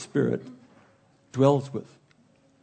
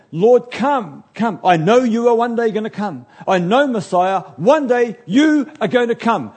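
put-on loud, pleading cry of a longing old man; fervent prayer; 7 semitones higher, 6 dB louder than usual. Simeon praying for Messiah, loud and pleading. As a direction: call out to God, begging him to come.

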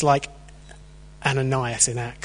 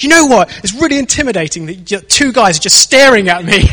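high, loud mock yell at two staring guys; a woman's loud hammed-up complaint; retold shout; 6 semitones higher, 8 dB louder than usual. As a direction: blast it at them, half laughing. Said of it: "she shouts in my face".

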